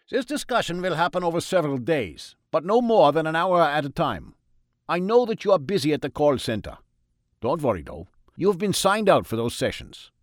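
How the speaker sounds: put-on high, loud German-accented doctor; a jolly German doctor offering cocaine; Freud in German accent. A narrator's reading. Freud offers God cocaine, German-accented and high.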